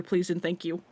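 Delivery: normal talking voice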